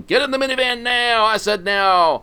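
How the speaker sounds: loud, high, impatient holler; hammed-up bossy yell; yelling Karen. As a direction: bellow it like a fed-up minivan mom. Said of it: "with flaming sticks and yelling".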